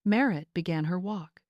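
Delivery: ordinary delivery, nothing marked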